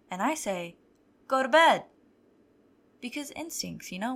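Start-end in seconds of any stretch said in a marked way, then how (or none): 1.3-1.9 sharp loud order through the door; shout. Retold or imitated. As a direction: yell go to bed at the kid. Mom yelling through bathroom door.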